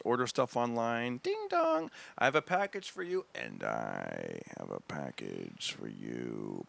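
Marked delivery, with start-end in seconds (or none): 1.2-3.3 sing-song doorbell then a higher, teasing delivery line; mock delivery guy. Comic tells a stage bit, as a teasing delivery man. 3.3-6.6 much lower, very slow and softer, over music; mock seductive reply. Comic answers the delivery man in a sultry low voice.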